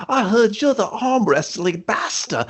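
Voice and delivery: put-on high, slow British orphan kid voice; little orphan kid. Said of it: "some little orphan kid runs up".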